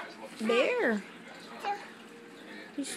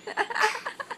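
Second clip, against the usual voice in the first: laughing delight; 6 semitones higher, 6 dB louder than usual. Real, not acted. Mother delighted by toddler.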